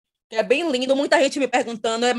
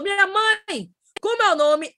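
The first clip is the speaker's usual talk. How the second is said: put-on higher sly kid question; imitating her son; 8 semitones higher, slower than usual. Mother voicing her teasing son, lightly higher. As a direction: bait mom with an innocent question.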